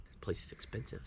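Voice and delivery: whispered aside; under the breath, unvoiced. Real, not acted. Whispered aside about store.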